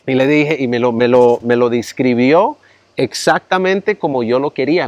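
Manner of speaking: ordinary talk, nothing marked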